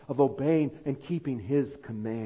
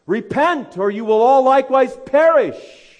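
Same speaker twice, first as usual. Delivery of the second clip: a child mimicking Whitefield's British accent, much higher and louder; boy's Whitefield impression; 12 semitones higher, 14 dB louder than usual. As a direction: thunder it out as a small boy imitating Whitefield in his British accent. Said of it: "in Whitefield's voice and accent".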